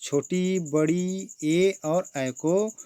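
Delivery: slow, stretched teacher recital; slow drawn-out listing. Shown as a demonstration. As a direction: draw out each vowel slowly. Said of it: "किसको किसको रखा गया है?".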